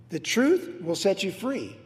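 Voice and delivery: normal talking voice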